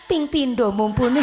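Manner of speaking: light mock voice of a tearful fan mother; imitating weeping mother. Tearful mother answering the preacher's question, mild mock weeping. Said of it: "Ibu-ibu nangis-nangis begini".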